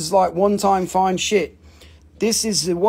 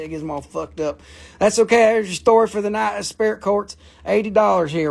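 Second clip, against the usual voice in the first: ordinary talk, nothing marked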